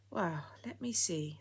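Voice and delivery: put-on slow, breathy old lady whisper; slow, whispered musing. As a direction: think it over in a hush. Old lady musing on her past.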